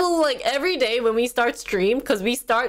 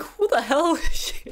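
laughing disbelief; 6 semitones higher than usual. Retold or imitated. Shocked by her rap.

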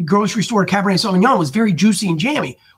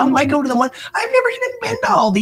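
very high, quick flustered admission; flustered guest; 9 semitones higher, faster than usual. Retold or imitated. Neighbor admitting never shopping at Aldi, high fast fluster. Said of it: "neighbor's reply".

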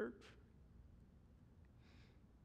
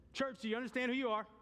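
higher, pressing ask; brighter repeated question; 6 semitones higher, faster than usual. Shown as a demonstration. Pastor repeating it, pressing the church. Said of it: "Not a rhetorical question".

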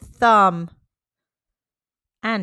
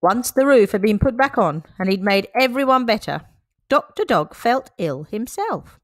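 plain reading voice

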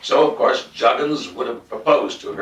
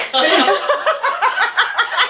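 high, bright laugh breaking out; burst of laughter; 3 dB louder than usual. Real, not acted. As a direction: laugh out loud at the joke. Reader laughs at own story.

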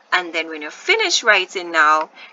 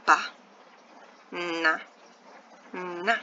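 Ba, Na, Na with long gaps between them; each syllable of Ba Na Na standing alone; 2 semitones lower, 4 dB quieter, slower than usual. Shown as a demonstration. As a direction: sound out banana one beat at a time. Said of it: "Let's try to break it up".

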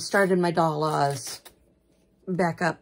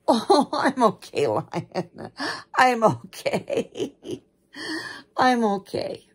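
loud, higher cooing reassurance; reassuring; 4 semitones higher, 5 dB louder, slower than usual. Real, not acted. Owner telling the dog they are okay, reassuring. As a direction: soothe an anxious pet, voice lifted and loud.

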